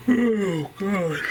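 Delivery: ordinary talk, nothing marked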